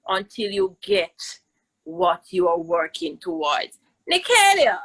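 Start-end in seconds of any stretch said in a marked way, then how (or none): 4.1-4.8 raised call of a name, higher and louder. Real, not acted. Teacher calling out to her pupil, loud call.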